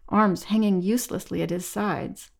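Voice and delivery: ordinary delivery, nothing marked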